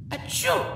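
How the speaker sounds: small breathy sneeze; a tiny stifled achoo; quiet little sneeze. Acted. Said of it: "a quiet little sneeze".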